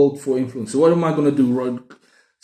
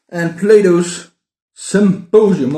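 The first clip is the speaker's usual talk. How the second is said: slow, louder, higher title announce; announcing title; 5 semitones higher, 7 dB louder, slower than usual. Shown as a demonstration. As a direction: present the title proudly.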